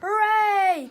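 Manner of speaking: loud, high, jubilant cry; one long gleeful whoop; children's cheer. A narrator's reading. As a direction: cheer like a class of kids. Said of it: "cried the children".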